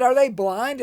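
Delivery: fed-up indignant cry; angry outburst. Retold or imitated. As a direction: blurt it out in rising anger. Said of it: "I'm starting to get really angry now".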